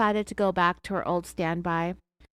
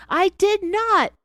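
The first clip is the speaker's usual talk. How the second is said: high, loud, slow protest; loud, drawn-out "I did not"; indignant denial; 12 semitones higher, 8 dB louder, slower than usual. Retold or imitated. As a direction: fling the denial back at him. Acted interrogation quarrel, denial.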